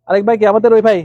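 usual speaking voice

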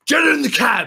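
high, loud mock command; acted urgent shout. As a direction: yell it like a frantic anime line. Acted shout in retold scene.

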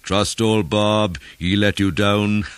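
high teasing taunt; mocking jeer. A narrator's reading. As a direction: jeer at him, loud and high. Mocking men jeer at Bob.

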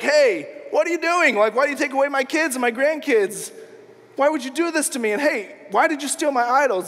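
high-pitched, protesting mock outrage; indignant confrontation. Laban confronts Jacob over taken kids and stolen idols, indignant. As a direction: demand answers in an acted-out, aggrieved voice.

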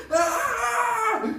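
very high, loud mock scream; hammed-up shriek of terror; panicked scream. Crowd member fleeing Frankenstein, hammed-up panicked scream. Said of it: "everyone s-, starts freaking out".

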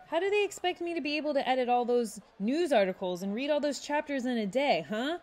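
mock the workload with a sneer; sarcastic. A narrator's reading. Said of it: "Xavier said sarcastically".

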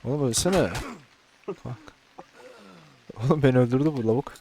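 frustrated yelling. Real, not acted. Fighting enemy in game.

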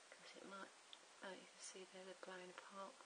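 soft whispered aside; muttering to herself. Real, not acted. Crafter watching her pieces, whispering to herself. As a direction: mutter it under your breath.

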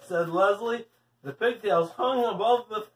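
usual speaking voice

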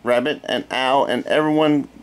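usual speaking voice

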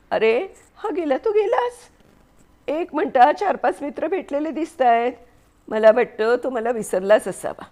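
a peeved woman left waiting alone; annoyed wife. A narrator's reading. Mary annoyed, Lincoln gone.

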